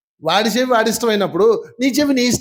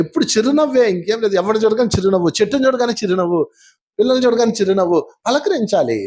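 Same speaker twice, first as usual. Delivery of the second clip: usual speaking voice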